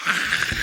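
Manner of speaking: breathy laughing mock scream. Startled by the cleaning lady, a laughing mock scream. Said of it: "we screamed at each other".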